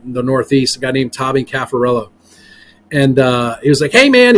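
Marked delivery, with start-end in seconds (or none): 3.9-4.4 high, loud Boston greeting; lightly imitated Boston accent. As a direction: imitate a Boston investor hailing you. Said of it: "he's got this real strong Boston accent".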